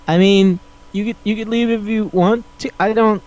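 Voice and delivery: acted uncomfortable politeness; awkward hedging. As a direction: stammer out an uneasy go-ahead. Acted awkward reply to houseguest.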